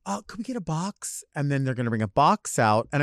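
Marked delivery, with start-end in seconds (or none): 0.0-1.2 soft request. Retold or imitated. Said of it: "I'm gonna say".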